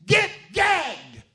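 high, very loud yell; shout. Retold or imitated. Jesus rebukes the storm, loud high shout. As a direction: roar it at the wind. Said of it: "The Greek word means".

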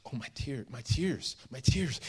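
soft, fretful repeats, mildly tearful; lightly acted weeping, starting hushed; acted crying. Woman with no towel or water, lightly acted crying. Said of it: "So she's crying".

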